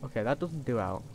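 usual speaking voice

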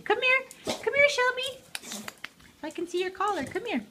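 high pet-talk call; calling the dog. Real, not acted. Coaxing dog to show collar.

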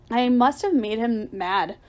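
plain reading voice

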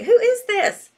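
high, loud, startled "who is this"; mock surprise. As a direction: pretend to be caught off guard by a stranger's name. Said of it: "I'm like".

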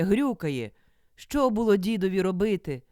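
usual speaking voice

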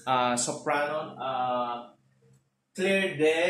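ordinary talk, nothing marked

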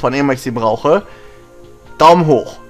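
ordinary delivery

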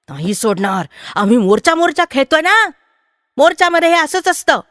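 put-on defiant boys. A narrator's reading. Boys defiantly answer women.

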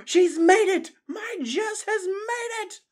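very high, loud jubilant cry; overjoyed shout of triumph; joyful cheer. A narrator's reading. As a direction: cheer it out, stretching the words. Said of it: "clapped his jo-, paws joyfully".

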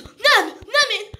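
high, drawn-out protest; frightened denial. Acted. Suspect denying he destroys the village, frightened. Suspect denies under interrogation.

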